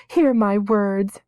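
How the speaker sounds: slow, high, loud prayer; girl praying to the nameless ones. A narrator's reading. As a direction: pray it earnestly. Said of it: "prayed Ariel".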